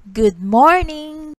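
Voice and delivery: big cheerful shout of good morning; loud greeting. Real, not acted. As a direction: call it out loud to the whole class. Teacher greets class loudly.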